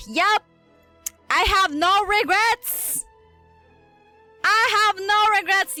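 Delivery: high, drawn-out triumphant cry; excited exclamation. Real, not acted. Streamer reacting to a character reveal, excited exclamation. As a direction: shout it out high, stretching the words.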